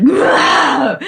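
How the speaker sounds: a playful, hammed-up outburst; mock anger release. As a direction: let the anger blow out of you. Acting out anger in kitchen.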